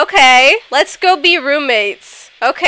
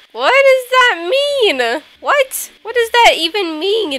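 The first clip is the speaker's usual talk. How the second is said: baffled whine; 9 semitones higher than usual. Real, not acted. Player confused by girl shaking her head, high baffled whine.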